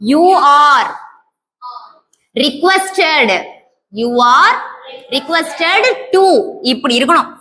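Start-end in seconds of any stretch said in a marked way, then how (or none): 0.0-6.2 each word drawn out very slowly for the class; slow dictation. Shown as a demonstration. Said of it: "ப்ளீஸ்ன்னு வந்தாலே". (மிக மெதுவாக dictation சொல்வது)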